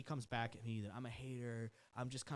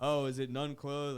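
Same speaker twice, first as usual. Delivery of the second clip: mocking jab; 8 dB louder, slower than usual.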